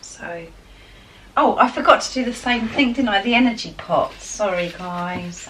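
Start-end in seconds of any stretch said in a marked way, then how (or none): 1.3-4.1 bright burst of realisation, higher and louder; suddenly remembering, all worked up; excited. Real, not acted. Reader remembering her energy pots, excitedly. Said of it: "I'm getting so excited".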